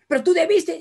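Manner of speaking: high, loud mock scolding; grumbling reproach. Grumbler blaming the other, high and loud reproach. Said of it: "Escuchen a los renegones".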